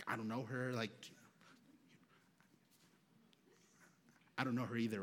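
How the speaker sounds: low, soft disclaimer; hushed aside. Retold or imitated. Strangers studying trade looks about the crying woman, hushed aside. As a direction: murmur it discreetly across the room.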